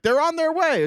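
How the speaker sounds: airy promise in a lightly put-on voice; a hint of a breezy official; mocking Pam Bondi. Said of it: "And then she's like".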